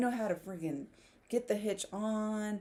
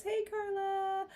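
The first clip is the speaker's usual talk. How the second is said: slow, higher sing-song greeting; reading a viewer's greeting; 10 semitones higher, slower than usual. Retold or imitated. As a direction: draw out the hey, a lightly voiced friendly hello. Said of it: "Eva says".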